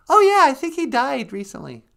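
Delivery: very high, loud chatty voice; high-pitched cartoonish reply; squeaky sidekick. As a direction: pipe up as a tiny sidekick. Sidekick voice answers host.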